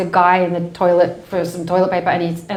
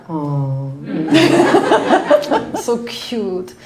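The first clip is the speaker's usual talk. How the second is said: condescending cooing; much slower than usual. Retold or imitated. Listeners coo at her accent claim.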